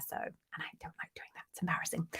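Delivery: hushed, embarrassed half-whisper; whispered aside. Real, not acted. Host confessing to viewers she mangles names, whispered. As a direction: drop to a confiding whisper.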